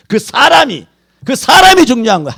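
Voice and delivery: loud, high, hammering sermon voice; preaching at full force. Acted. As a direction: shout the point home to the congregation. Preaching climax. (온 힘을 다해 외치는 설교)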